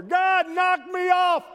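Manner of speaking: very high, loud yelled challenge; professor hollering. Acted. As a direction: bellow the dare so the hallway hears. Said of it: "heard the professor holler".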